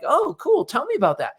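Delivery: mock-keen party chat, voice shooting up; eager interest. Acting out an eager small-talk reply.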